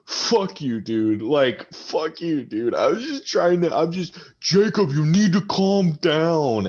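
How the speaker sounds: put-on high, slurring drunk arguing with his buddy; drunk quarrel. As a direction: snap at your drunk friend to calm down.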